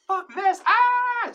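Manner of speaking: high, loud terrified shriek; panicked scream. Retold or imitated. Son fleeing his possessed mother, screaming in terror. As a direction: scream it and bolt.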